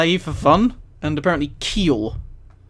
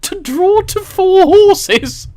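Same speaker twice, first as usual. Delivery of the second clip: much higher and louder, slow, laughing through it; 14 semitones higher, 9 dB louder, much slower than usual. Real, not acted. Laughing while reading punishment list.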